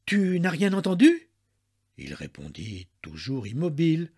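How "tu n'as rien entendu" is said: higher, louder anxious question; flustered, raised voice; panicked question. A narrator's reading. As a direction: ask it in a mild panic. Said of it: "Affolée, elle reprit enfin".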